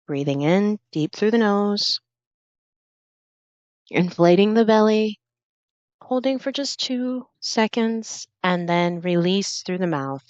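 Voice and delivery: guided breathing. Acted. Leads slow breathing exercise.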